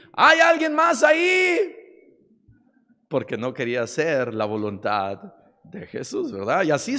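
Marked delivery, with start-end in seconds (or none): 0.1-1.8 very high, loud call for someone else; acted shout. Young man, unwilling to let go, calls for other help, shouting. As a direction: yell out high, hunting for another rescuer. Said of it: "comienza a gritar".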